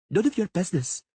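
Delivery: put-on high, sniffy little girl; girl snubbing the Witcher, sniffy and high. A narrator's reading. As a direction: toss it off with a sniff. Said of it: "she sniffed".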